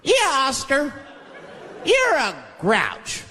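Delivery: put-on high taunting kid voices; high mock child jeer. Kids chanting at Oscar.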